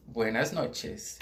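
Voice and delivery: buenas noches slowly; slow Spanish. Shown as a demonstration. Teacher gives the answer buenas noches slowly. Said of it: "A gente diria buenas noches".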